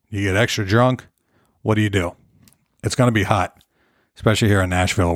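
ordinary talk, nothing marked

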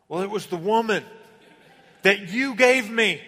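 hammed-up Adam shifting the blame; Adam blaming. Adam excusing himself to God, a loud defensive whine. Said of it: "And Adam said".

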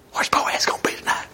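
hushed, voiceless conspiratorial whisper; gossiping whisper. Acted. Women secretly gossiping about Boaz.

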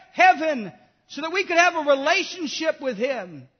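loud, driving sermon voice; fiery preaching. Acted. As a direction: preach it hard to the congregation. Preaching at full force.

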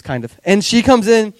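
ordinary talk

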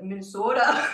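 mock Minnesota twang on the word; Minnesota accent. Reporter recalling how Montanans spoke, mock Minnesota accent. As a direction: lightly imitate a Minnesota accent. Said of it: "they had a Minnesota accent".

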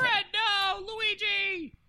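high-pitched, loud cry of alarm; dismayed outcry. Acted. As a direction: wail the names in mock horror. Player cries out in game.

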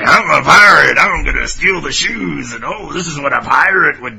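loud hammed-up pirate boast; mock swaggering pirate; Rupert impression. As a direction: shout it like a gung-ho pirate. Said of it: "It's the Rupert impression".

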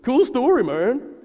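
high loud sarcastic cool story man; a bro cheering way too loud; mock excited crowd heckle; dudes' mock enthusiasm. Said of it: "some of the dudes in the room go".

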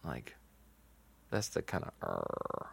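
soft unvoiced creaky fry; sexy low fry at the end; vocal fry. Shown as a demonstration. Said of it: "I think it can be very sexy".

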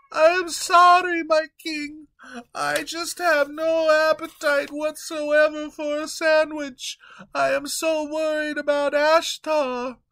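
high, fretful servant voice; worried young servant pleading with his king; timid servant Peter. Acted. Peter figure again.

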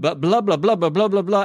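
sing-song mock of a nagging dad; mock lecturing blah blah. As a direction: parody an endless parental lecture. Mocking a lecturing parent.

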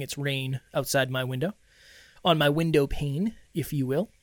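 ordinary talk, nothing marked

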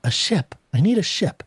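mildly imperious demand, rising high. A narrator's reading. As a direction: state the demand as if expecting obedience. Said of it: "she spoke imperiously".

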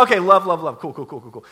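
hurried brush-off, loud start dropping away; dismissive. Acted. Disciples brushing off Jesus' talk of love, dismissive. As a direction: rattle it off to brush the topic aside.

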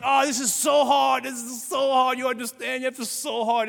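mock whining complaint. Mock complaint of struggling believer.

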